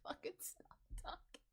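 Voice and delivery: whispered aside. Real, not acted. Whispered joke about herself.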